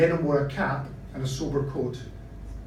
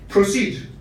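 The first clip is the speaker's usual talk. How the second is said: official order; 3 semitones higher, 6 dB louder than usual. Acted. Patrol lets them go.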